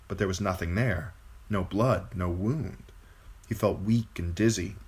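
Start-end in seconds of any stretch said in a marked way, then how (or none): none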